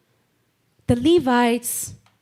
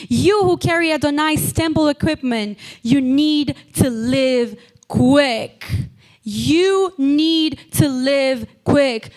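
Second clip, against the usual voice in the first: raised, higher, urgent preacher's voice; forceful preaching; 4 semitones higher, 4 dB louder than usual. Real, not acted. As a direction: preach it as an urgent command, stretching the key words.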